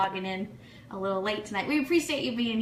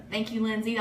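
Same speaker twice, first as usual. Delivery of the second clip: ordinary talk, nothing marked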